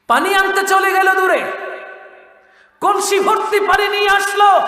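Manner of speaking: high, loud fiery sermon; fiery preacher shouting the verse; full-force preaching. Acted. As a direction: thunder the warning at the crowd.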